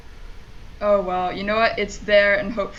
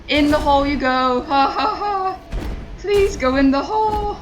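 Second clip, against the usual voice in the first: desperate manic pleading; 8 semitones higher than usual. Real, not acted. Player begging the piece to go in, manic pleading. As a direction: beg the game, half hysterical.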